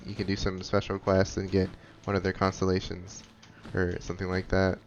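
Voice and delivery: usual speaking voice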